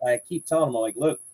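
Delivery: normal talking voice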